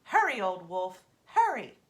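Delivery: loud, rising urging shout; urgent call. A narrator's reading. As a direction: hurry the old wolf along. Raven urges the running wolf.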